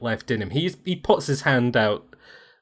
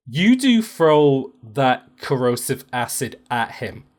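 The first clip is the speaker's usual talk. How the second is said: ordinary delivery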